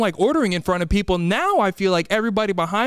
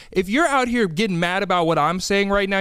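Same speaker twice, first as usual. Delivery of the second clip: ordinary talk, nothing marked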